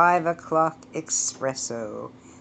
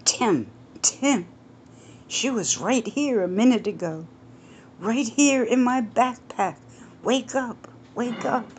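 urgent, higher voice shaking a friend awake; raised, hurried worry; worried young man; 7 semitones higher than usual. Acted. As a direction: rouse him fast, anxious. Justin wakes his friend urgently.

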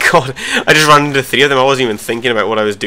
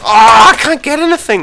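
high, loud, slow wail of frustration; frustrated yell; 13 semitones higher, 10 dB louder than usual. Real, not acted. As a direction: howl it out, fed up. Frustrated gamer outburst.

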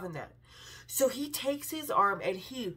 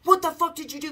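higher, louder retold challenge; feisty retort; 5 semitones higher, 6 dB louder than usual. Eddie confronting the frat boy who punched the window, feisty retold shout. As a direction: protective snap at the attacker, half-acted. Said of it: "Eddie got real feisty".

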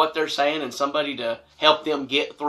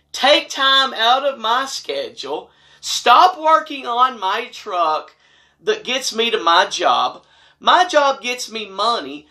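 pointed lecture; 6 semitones higher, 3 dB louder than usual. Retold or imitated. Lecturing the mom on priorities.

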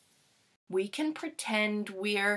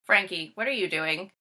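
plain reading voice

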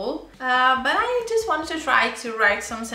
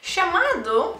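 surprised; 7 semitones higher than usual. Real, not acted. Surprised at the correction.

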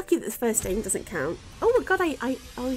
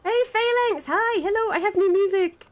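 excited greeting; 7 semitones higher, 4 dB louder than usual. Real, not acted. Greets streamer friend excitedly.

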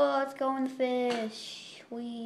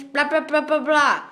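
loud dismissive blah, blah; mocking blah blah; 3 semitones higher, 8 dB louder than usual. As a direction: drown out the request with blahs. Mocking nagging request.

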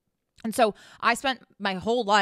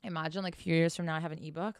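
hushed aside; 4 semitones lower, 7 dB quieter, faster than usual. Real, not acted. Imagining having an ebook.

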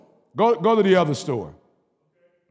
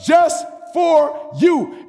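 God's voice, shouted; 8 semitones higher, 3 dB louder, slower than usual. Acted.

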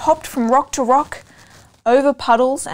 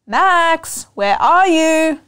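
loud, high calling voice, slow; a mother calling her son from up the road; mum calling out; 6 semitones higher, 6 dB louder, much slower than usual. Acted. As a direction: call across the road for the boy. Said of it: "she shouted".

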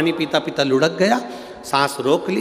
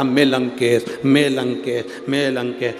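ordinary delivery

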